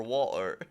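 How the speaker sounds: "water" said without its T; T dropped. Shown as a demonstration. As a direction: drop the middle T in "water". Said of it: "when the T is gone".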